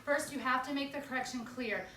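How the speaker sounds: normal talking voice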